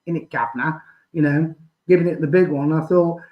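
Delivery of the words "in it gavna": imitating a fake Cockney geezer; lightly put-on Cockney accent; mock Cockney. Mocking a caller's fake Cockney accent. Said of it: "talking in his fake Cockney accent".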